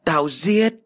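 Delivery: high-pitched angry outburst; gào lên. A narrator's reading. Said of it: "Đường Dũng liền gào lên". (gào dọa, giọng vút cao)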